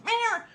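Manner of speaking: high, loud mock meow; imitated cat meow. Host voices the cat in the background, high mock meow. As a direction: squeal it like a cat. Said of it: "that back there saying".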